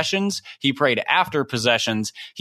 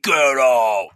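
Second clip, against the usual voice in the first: imitating pastor's get out; 2 semitones lower, 4 dB louder than usual. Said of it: "he'd be like".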